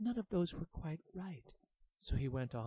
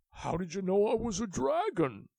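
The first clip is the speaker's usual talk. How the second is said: surprised dragon; 5 semitones higher, 8 dB louder than usual. A narrator's reading. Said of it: "dragon asks".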